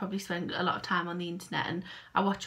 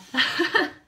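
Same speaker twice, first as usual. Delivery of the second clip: high giggle; 9 semitones higher, 7 dB louder than usual. Real, not acted. Joking about falling out.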